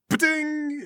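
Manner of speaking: high, sustained bell ding; imitated bell. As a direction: ring out like a struck bell. Imitates the bell being hit.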